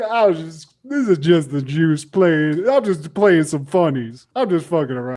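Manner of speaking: playful mock excuse; O J laughing it off. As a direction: imitate a man brushing it off as a joke. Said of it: "then he just starts laughing. He's like".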